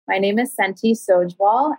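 ordinary talk, nothing marked

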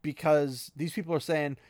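ordinary talk, nothing marked

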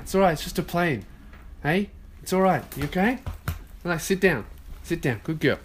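calm a frightened pet; soothing the dog. Real, not acted. Soothing his scared dog Olive as a plane passes, softly reassuring.

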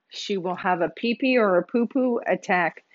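usual speaking voice

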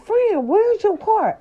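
panicked, pleading with her friend; high-pitched frantic where's your car. Retold or imitated. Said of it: "I'm like".

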